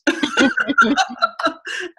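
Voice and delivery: giggling over being a crying mess; real laugh. Real, not acted. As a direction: laugh out loud at the memory. Laughing while retelling her tears.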